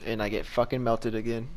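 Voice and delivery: normal talking voice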